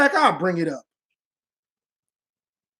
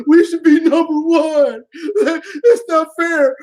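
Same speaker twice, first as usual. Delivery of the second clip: mock crying coach; 3 semitones higher, 3 dB louder than usual. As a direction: fake whiny sobbing, pitched up. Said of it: "y'all coach was".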